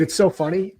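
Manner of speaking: ordinary talk, nothing marked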